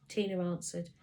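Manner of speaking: usual speaking voice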